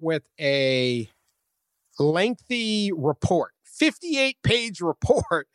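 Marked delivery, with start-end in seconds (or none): none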